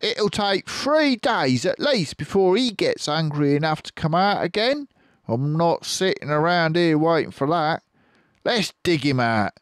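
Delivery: high, loud impatient farmer voice; Bean the farmer. Acted. Bean urging the others to dig, high and impatient. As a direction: push for digging as Bean. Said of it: "Then he said".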